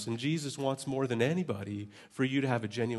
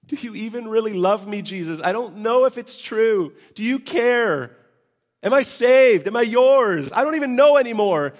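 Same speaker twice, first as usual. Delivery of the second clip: much higher, louder crying out; anguished prayer; 11 semitones higher, 12 dB louder than usual. Retold or imitated. Preacher reenacting his desperate cry to Jesus. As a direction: cry out to God in despair. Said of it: "praying those things".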